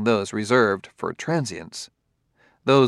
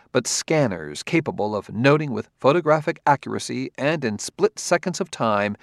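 ordinary delivery, nothing marked

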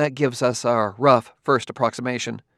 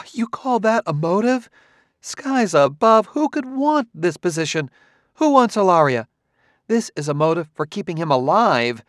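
higher, incredulous woman's voice, louder; a higher, mocking female character voice; Clarissa, incredulous; 7 semitones higher, 5 dB louder than usual. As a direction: scoff at the idea of a motive. Narrator voices Clarissa mocking motive.

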